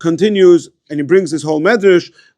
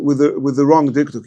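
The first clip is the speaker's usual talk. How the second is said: normal talking voice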